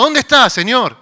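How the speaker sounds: high, loud plea to the Lord; crying out. Retold or imitated. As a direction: call out desperately for help. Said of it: "a veces gritamos". (clamor fuerte y agudo)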